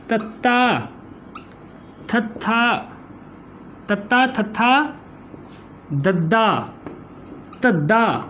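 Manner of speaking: ਤੱਤਾ, ਥੱਥਾ, ਦੱਦਾ, ਧੱਦਾ spaced out with long pauses; tongue on upper teeth for ਤੱਤਾ, ਥੱਥਾ, ਦੱਦਾ, ਧੱਦਾ; dental sounds demo. Shown as a demonstration. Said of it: "ਹੁਣ ਇਹਨਾਂ ਦਾ ਉਚਾਰਨ".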